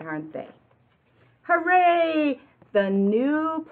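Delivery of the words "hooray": high, loud hooray; cheer. A narrator's reading. Reading the finish to kids, a high loud cheer.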